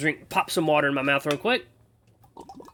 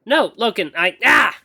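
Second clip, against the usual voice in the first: higher, louder exasperated outburst; frustrated; 8 semitones higher, 8 dB louder, slower than usual. Acted. Acolyte losing patience with her mentor, frustrated. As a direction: break off into a frustrated cry. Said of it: "It's hard to contain my frustration".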